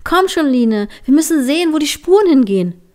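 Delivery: high, loud, fast call ahead; excited call to a friend lagging behind. Acted. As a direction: shout back over the snow, urging her on. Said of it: "und rief".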